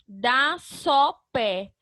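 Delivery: spaced-out teacher's syllable reading. Shown as a demonstration. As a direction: read out DÁ, SÓ, PÉ one at a time. Reading out separate syllables to combine.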